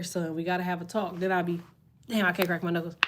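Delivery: ordinary talk, nothing marked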